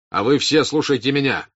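stern officer's command; commanding address. A narrator's reading. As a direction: address the whole crowd forcefully. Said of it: "Ростов обратился к мужикам".

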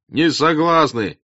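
high, loud, drawn-out "не согласны"; peasant's loud refusal. Acted. Second peasant echoes the refusal, loud and slow. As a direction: stretch the refusal out over the crowd. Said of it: "раздалось в толпе с разных сторон".

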